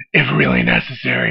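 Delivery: deep gravelly Batman growl; low, growling caped crusader. Acted. Holds the Batman voice.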